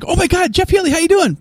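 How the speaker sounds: high, loud starstruck fan gushing. Retold or imitated. Fan meeting his hero, gushing up high and loud. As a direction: gush like a starstruck fan spotting his idol. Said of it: "your natural reaction when he walks by is".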